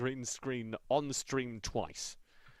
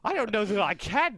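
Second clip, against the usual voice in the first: high, louder, giggling admission; giggly helplessness; 9 semitones higher, 10 dB louder than usual. Real, not acted. Derailed host admitting he can't go on, giggly. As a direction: laugh out the line.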